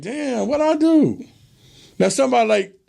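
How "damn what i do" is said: higher, slow, baffled what-did-I-do; a man thrown by the apology, stunned; confused brother. Retold or imitated. Brother reacting to his girl's sudden apology, baffled. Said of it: "But the brother like".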